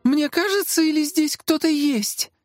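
very high, uneasy question; high voice, slowing uneasily; nervous wondering. A narrator's reading. Assistant senses an intruder, nervous.

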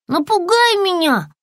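high, insistent little lizard cry; high-pitched yell from a small creature; shouted demand. A narrator's reading. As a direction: call it out high and urgent. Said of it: "закричала она".

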